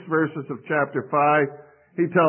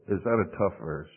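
ordinary talk, nothing marked

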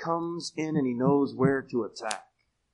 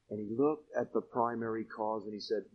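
normal talking voice

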